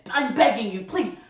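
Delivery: plead, losing patience; exasperated pleading. Acted. Son begging his mother on the phone, exasperated pleading.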